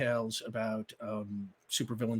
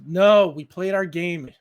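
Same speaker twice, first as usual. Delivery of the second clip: firm refusal; 5 semitones higher, 10 dB louder than usual. Acted. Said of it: "he says".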